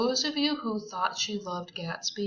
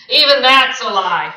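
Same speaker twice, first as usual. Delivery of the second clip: loud savage retort, a bit higher; 4 semitones higher, 15 dB louder than usual. A narrator's reading. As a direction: spit it back with savage scorn. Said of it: "said Tom savagely".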